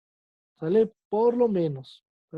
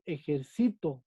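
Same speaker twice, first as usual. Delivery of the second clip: "ejercito" with CI stressed and a falling, lower ending; stress on penultimate syllable; 3 semitones lower, 4 dB quieter, slower than usual. Shown as a demonstration. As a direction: say "ejercito" leaning on CI, letting TO drop. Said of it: "La sílaba tónica está en sí".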